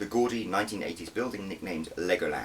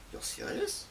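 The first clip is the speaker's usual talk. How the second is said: stunned disbelief; 6 dB quieter, slower than usual. Acted. Stunned by news of assignment.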